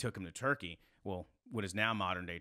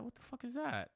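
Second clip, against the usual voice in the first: much higher, amazed question; retold disbelief; 13 semitones higher, 6 dB quieter than usual. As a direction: ask it stunned, voice jumping up. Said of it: "I was like".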